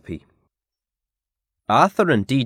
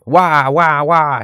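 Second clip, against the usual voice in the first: put-on storybook baby crying noise; mock wailing, a little raised; imitated baby wail; 4 semitones higher, 5 dB louder, slower than usual. A narrator's reading. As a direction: read out a baby's wail, drawn out. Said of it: "she cried".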